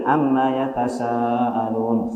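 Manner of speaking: Amma yatasaalun read slowly with the mm of Amma not pressed; flowing, no stress. Shown as a demonstration. Teacher models the correct unstressed reading for class. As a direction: let Amma flow lightly, no doubling. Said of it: "mengalir saja ya".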